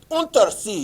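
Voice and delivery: loud, high German-accented "Untersee"; mock German accent. Shown as a demonstration. Drills the German name emphatically.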